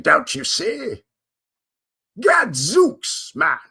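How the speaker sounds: put-on 'tis no entry, a shouted posh British accent; fancy British guard. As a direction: ham up a pompous English sentry turning people away. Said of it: "a fancy British lord, one of his minions guarding the gate".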